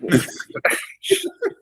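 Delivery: squeaky unvoiced giggle; high breathy laugh. Real, not acted. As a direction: let out a thin airy laugh. Laugh after complaint.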